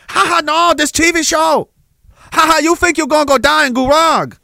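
high, hammed-up Chinese accent; jeering cop in a caricatured Chinese accent; mock Chinese cop. Chinese cop teases terrified man, mock Chinese accent.

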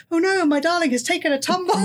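hammed-up horror-movie dismay, pitched high; over the top. Dorothy after pushing Edmund downstairs, hammed-up mock dismay. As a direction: play a melodramatic mock lament. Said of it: "then sort of over the top".

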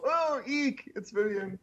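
high, soft little shriek of horror; mock squeal. Visitors recognizing their friend's body, mock squeal. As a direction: squeak it in pretend fright.

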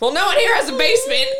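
high and loud insistence; emphatic. Real, not acted. Arguing with cohost that Texans lack basements, loud. Defending her point.

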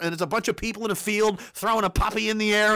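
usual speaking voice

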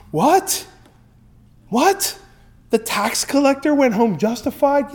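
high, incredulous what; shocked crowd. Retold or imitated. The crowd reacts to Jesus' verdict, stunned. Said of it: "the people probably went".

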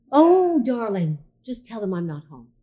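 mock the mother's airy "Oh, darling"; imitating mom. Retelling her mother's words to a friend, lightly imitating mom. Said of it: "Can we have the accent".

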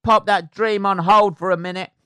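loud, high, cheeky mock Cockney taunt; mocking heckler voice. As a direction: bellow it like a cheeky geezer brushing off a dream. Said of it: "and somebody went".

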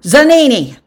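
high, loud roll call. A narrator's reading. Official calls the next man forward, loud. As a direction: call the name across a crowd.

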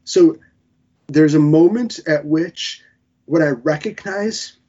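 ordinary talk

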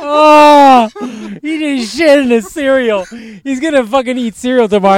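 high, laughing through the words; laughing hard. Real, not acted. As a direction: talk while cracking up. Laughing fit at friend's roast.

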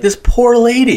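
high, loud exaggerated pity; pitying outburst. Viewer reacting to the robbers, high mock pity. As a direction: cry it out high like an outraged fan. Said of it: "I was like".

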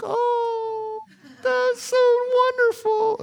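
very high falsetto, slow and gushing; mock doting wife. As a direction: coo it like an overjoyed wife, in falsetto. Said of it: "she's always like".

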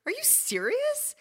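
high, slow, stretched out in disbelief; can't believe the luck; amazed disbelief. Retold or imitated. Retelling to cohost, high amazed disbelief.

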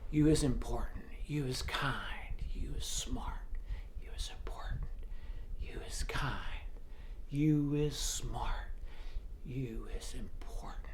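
soft tender whisper. Retold or imitated. Maid whispering comfort in the girl's ear. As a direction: breathe it into her ear.

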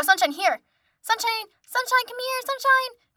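high-pitched coaxing call; calling a dog. Retold or imitated. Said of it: "But I'm calling for her".

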